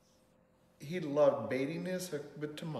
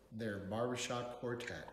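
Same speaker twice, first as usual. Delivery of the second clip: plain reading voice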